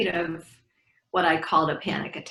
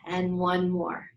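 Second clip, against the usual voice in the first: guided breathing. Acted. Leads a breathing exercise.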